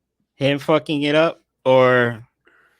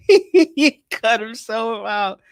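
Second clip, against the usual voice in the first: laughing; 6 semitones higher, much slower than usual. Real, not acted. Laughing at co-host.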